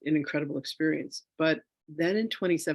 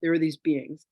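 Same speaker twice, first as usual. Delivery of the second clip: ordinary delivery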